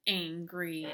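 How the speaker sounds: usual speaking voice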